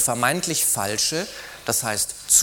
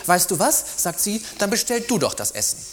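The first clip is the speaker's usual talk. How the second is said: annoyed; 8 semitones higher than usual. Acted. She snaps at her partner.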